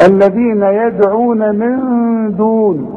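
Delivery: slow high chant, echo in يدعون, none in دون; bounced then plain د. Shown as a demonstration. Teacher contrasting two dals, qalqalah only in يدعون. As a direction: bounce the d of يدعون, open دون straight into its vowel. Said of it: "مفيش قلقلة هنا".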